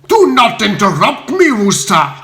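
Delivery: loud, high bellow. Acted. Spode bellowing at Bertie to stop interrupting. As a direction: roar him down. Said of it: "bellowed the uncouth peer".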